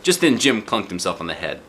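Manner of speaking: ordinary delivery, nothing marked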